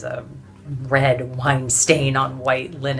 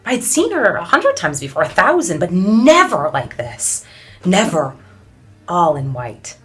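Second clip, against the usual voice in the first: high, loud, swept-up remembering; impassioned awe; 4 semitones higher, 7 dB louder than usual. Acted. Awed memory of Inez leading march.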